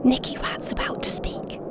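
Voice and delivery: shushing, very soft whisper; urgent-to-be-quiet stage whisper. Acted. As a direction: hush the others in a whisper. Hushing the others.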